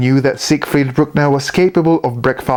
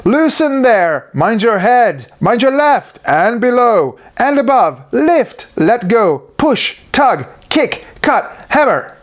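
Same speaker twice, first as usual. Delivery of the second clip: shouted orders; 9 semitones higher than usual. A narrator's reading. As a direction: bark orders at the workers. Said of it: "They started to shout".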